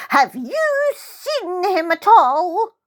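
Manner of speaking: put-on high, slow, tearful old lady; quavering old woman. Old woman asking after her cat.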